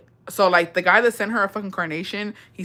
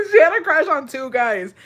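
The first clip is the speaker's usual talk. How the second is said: laughing; 6 semitones higher, 5 dB louder than usual. Real, not acted. Laugh just before.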